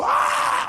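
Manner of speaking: high-pitched panicked "ah"; re-enacted scream of a skidding driver; mock scream. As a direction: cry out high, as if about to crash. Re-enacts scream as car skids.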